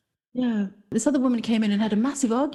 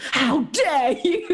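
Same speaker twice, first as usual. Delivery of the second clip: indignant; 3 semitones higher, much slower than usual. Retold or imitated. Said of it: "She went".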